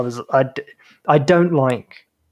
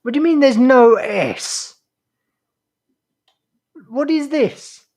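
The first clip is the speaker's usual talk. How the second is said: 1 indignant disbelief; 9 semitones higher, 3 dB louder than usual. Real, not acted.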